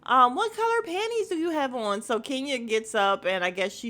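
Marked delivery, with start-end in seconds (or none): 0.0-2.0 high-pitched imitation of screaming; mock screaming. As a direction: call the name out high and long, mimicking. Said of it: "She's screaming it too".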